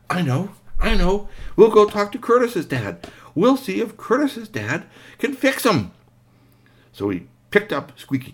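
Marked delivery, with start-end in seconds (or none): 0.1-5.9 put-on high, eager child; excited, high-pitched problem solving; sudden bright idea.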